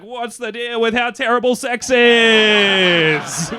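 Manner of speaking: high, loud, drawn-out stand-up bellow; hammed-up comedian's opener. Comedian opening a set to a crowd, hammed-up bellow. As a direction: stretch it out loud and high, milking the bit.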